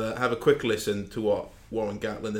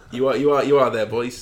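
calling out; 7 dB louder than usual. Real, not acted. Host teasing silent co-hosts.

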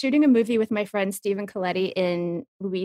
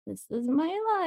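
dismayed aside; 8 semitones higher, 5 dB quieter than usual. Retold or imitated. Acting out dismayed reaction.

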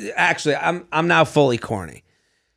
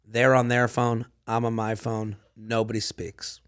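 deadpan; 7 semitones lower, 8 dB quieter than usual. Retold or imitated. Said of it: "describes perfect date deadpan".

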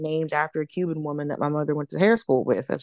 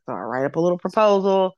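usual speaking voice

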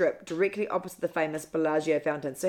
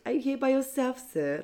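higher, soft and overly polite; mock polite receptionist; 6 semitones higher, 4 dB quieter than usual. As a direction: ask sweetly like a hotel clerk. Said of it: "ever so politely".

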